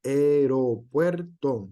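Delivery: Aeropuerto said slowly, the a and e kept in separate syllables; slow careful demo. Shown as a demonstration. Teacher sounding out an example word for the class, slowly. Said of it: "Estas palabras que se encuentran aquí".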